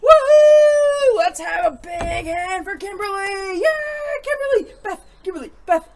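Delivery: cheering the winner's name. Real, not acted. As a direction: whoop like a crowd for her.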